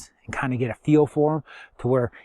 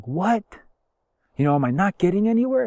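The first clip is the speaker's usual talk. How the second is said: imitated dismay; 6 semitones higher than usual. Acting out dismayed reaction.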